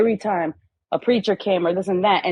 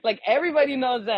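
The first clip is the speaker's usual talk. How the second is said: mock know-it-all; 5 semitones higher, faster than usual. Continuing the mock righteous voice.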